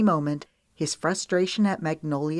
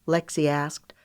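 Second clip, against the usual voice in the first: usual speaking voice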